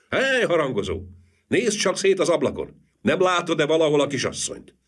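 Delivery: high, carrying hail to someone above; calling up. A narrator's reading. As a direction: call up to the bell tower. Calling up to man in tower.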